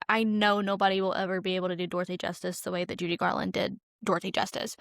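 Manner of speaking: usual speaking voice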